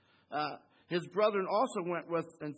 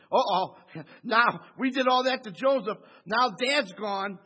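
brothers' dread, louder and hammed-up; mock worried brothers; 3 semitones higher, 9 dB louder than usual. Brothers fearing Joseph's revenge, hammed-up louder worry. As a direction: play the guilty brothers sweating the payback.

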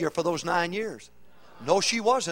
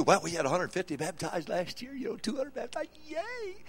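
mock cheer; 2 semitones higher, 9 dB quieter than usual. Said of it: "So we say to you".

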